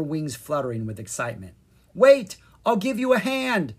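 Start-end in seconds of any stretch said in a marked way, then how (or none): 1.9-3.7 high, loud call; calling after. A narrator's reading. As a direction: call after someone leaving. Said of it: "Leon called after her".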